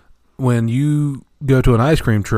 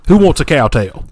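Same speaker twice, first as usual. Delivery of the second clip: loud vendor call; 5 semitones higher, 6 dB louder than usual. Pretend. Ice cream man call.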